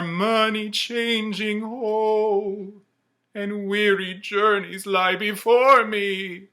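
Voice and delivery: high, loud, slow ghostly wail; Marley's ghost. Acted. The ghost tells Scrooge of his chain, wailing high and loud. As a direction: moan each word like a chained spirit.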